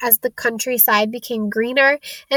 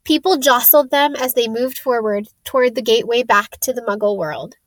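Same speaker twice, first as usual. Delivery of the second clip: ordinary delivery, nothing marked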